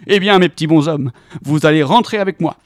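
loud jolly welcome; jolly Tom inviting hobbits home loudly; hearty invitation. A narrator's reading. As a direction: boom out the invitation.

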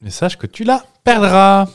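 slow, high and loud, hammed-up theatrical line; dramatic mock declamation. Podcast co-host hams up a line after the song title, mock theatrical. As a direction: declaim it like a grand romantic lyric, tongue in cheek.

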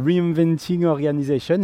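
English title in a French accent. Real, not acted. Joking about their own accent to co-hosts, French-accented English. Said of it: "avec mon bel accent".

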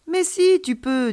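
put-on high, emphatic childlike reassurance; encouraging child. A narrator's reading. Alien child tells doubting friend it's possible, encouraging. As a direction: insist brightly, starting high and loud.

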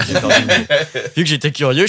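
real laugh breaking through the words. Real, not acted. Forgetting a word in front of friends, laughing.

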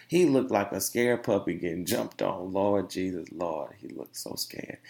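ordinary talk, nothing marked

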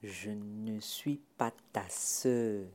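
very slow, low guttural growl. Acted. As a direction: drag each word out deep in the throat. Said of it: "répondit d'une voix gutturale". (voix gutturale, lente et grave)

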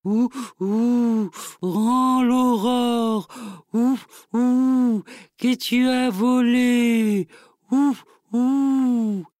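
eerie owl hooting its demand. Acted. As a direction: drag the words out like a hooting owl. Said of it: "et ulula".

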